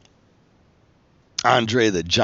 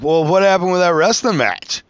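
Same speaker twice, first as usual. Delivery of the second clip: pointed question; 9 semitones higher, 6 dB louder than usual. Retold or imitated. Artist confronting wrestler.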